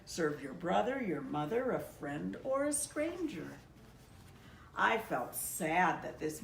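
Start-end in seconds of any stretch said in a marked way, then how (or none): none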